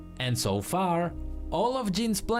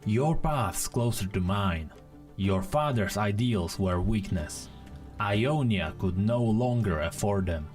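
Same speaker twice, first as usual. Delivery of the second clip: a touch lower, Zed-like figure's measured reply; lightly voiced weary rival; 5 semitones lower than usual. A narrator's reading. Weary rival answers his waiting foe, in a lightly lowered voice. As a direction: voice the weary foe slightly deep. Story gives Zed's speech.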